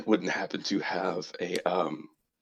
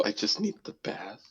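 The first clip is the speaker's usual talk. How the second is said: whispered insistence; 7 semitones higher, 7 dB quieter than usual. Acted. Insists he needs only a bath.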